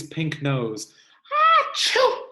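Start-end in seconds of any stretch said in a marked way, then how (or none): 1.6-2.2 loud high mock sneeze; hammed-up achoo; big sneeze. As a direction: act out a sneeze, full force. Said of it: "she sneezes".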